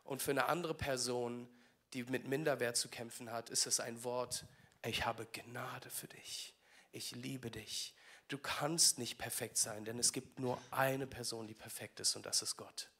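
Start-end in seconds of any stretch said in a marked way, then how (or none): 4.8-7.9 soft, slow whispered comfort; a hushed, tender word of grace. Acted. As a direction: breathe it gently like a loving promise. God's tender words to the insecure.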